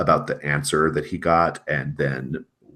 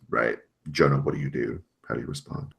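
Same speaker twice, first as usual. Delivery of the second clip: ordinary talk, nothing marked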